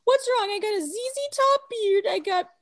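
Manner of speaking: high, fast whiny complaint; whiny kid voice. Retold or imitated. Kid whining to his dad, high and fast. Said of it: "And he's like".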